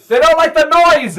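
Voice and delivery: exasperated wife shouting it out; shouted repeat. Acted. Repeats louder for earplugged husband.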